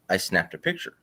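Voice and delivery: ordinary delivery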